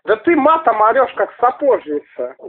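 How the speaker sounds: usual speaking voice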